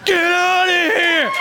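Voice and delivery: high, slow shout at a snake. Retold or imitated. Wife chasing a snake off the trail, high yell. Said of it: "picks up a four-foot stick and is like".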